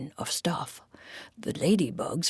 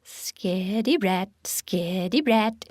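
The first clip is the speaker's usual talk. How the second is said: tongue-in-cheek; 3 semitones higher than usual. A narrator's reading. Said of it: "tongue-in-cheek".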